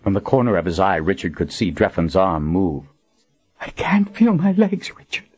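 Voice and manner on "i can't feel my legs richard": put-on high, trembling young woman's voice in panic; panicked woman. Wounded Colin pleads with Richard, panicked. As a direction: gasp it out, terrified. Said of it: "Her voice quivered with panic".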